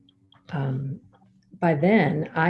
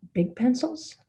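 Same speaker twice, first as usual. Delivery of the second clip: puzzled question; 7 semitones higher than usual. Retold or imitated. Questioning the boy's Christmas wish.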